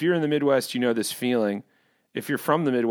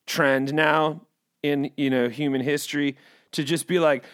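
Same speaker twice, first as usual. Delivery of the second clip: ordinary delivery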